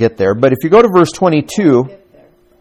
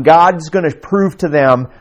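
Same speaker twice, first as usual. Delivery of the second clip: ordinary talk, nothing marked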